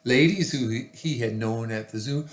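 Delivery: ordinary delivery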